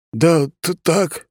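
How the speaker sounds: high, halting nervous stammer; startled clerk stumbling over words. A narrator's reading. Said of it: "запинкой выдавил из себя чиновник".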